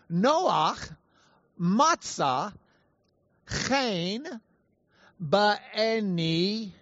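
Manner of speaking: "Noach matzah chen ba'eni" word by word in Hebrew; Hebrew reading. Shown as a demonstration. Preacher reads the verse in Hebrew, "Noach matzah chen ba'eni" carefully sounded out. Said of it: "If you want to read it in Hebrew".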